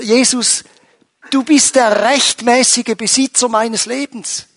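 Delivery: high and loud, driving each claim home; impassioned preaching. Acted. Pastor rousing the congregation about Jesus as owner, impassioned preaching. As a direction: preach it out to the congregation with full force. Preacher building to full force.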